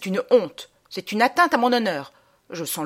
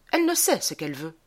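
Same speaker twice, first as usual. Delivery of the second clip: ordinary talk, nothing marked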